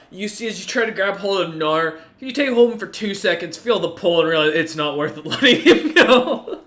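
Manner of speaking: ordinary talk, nothing marked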